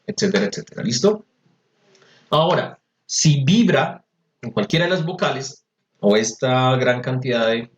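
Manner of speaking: ordinary talk, nothing marked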